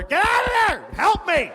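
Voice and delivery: high shouted plea through the door; panicked shout for help; frantic yell. Retold or imitated. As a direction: yell it like someone banging on the door. Wife yelling at bathroom door.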